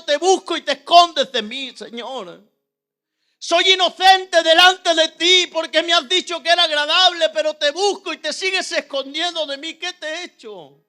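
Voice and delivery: retold impassioned complaint; aggrieved appeal, loud then dropping; protesting to God. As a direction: plead your innocence with force, easing at the end.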